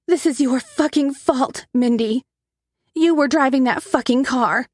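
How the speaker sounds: angry blame. A narrator's reading. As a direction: hurl the blame at her sister. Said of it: "she erupts in anger".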